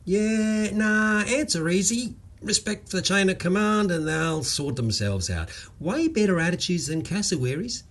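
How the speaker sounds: high, easy-going Aussie bloke voice; lightly voiced Australian bloke; cheerful Queensland bloke. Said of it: "Walker shrugged modestly".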